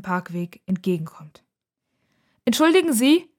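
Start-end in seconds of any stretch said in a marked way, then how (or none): 2.4-3.3 high, loud call. A narrator's reading. Girl calls to the approaching old man, calling out. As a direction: call out to get his attention. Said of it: "ruft sie ihm entgegen".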